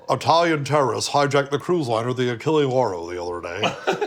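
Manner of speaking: newsman swallowing his L's; Brokaw no-L impression. Comic retelling a Tom Brokaw impression, dropping L's. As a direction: read it like an anchor who can't say L. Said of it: "a great impression in his stand-up".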